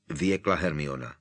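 ordinary delivery, nothing marked

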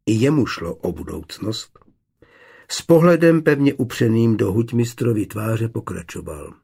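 plain reading voice

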